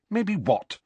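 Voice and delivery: a high, snappish demand. Acted. Hermione snapping at Ron's hesitant maybe, snappish. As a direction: snap the question back. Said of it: "rather snappishly".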